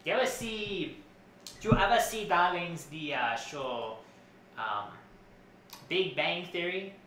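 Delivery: lilting, theatrical designer character voice; fussy costume designer Edison. Acted. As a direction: play a self-important fashion designer, pitch swooping. Plays Edna Mode's cousin Edison.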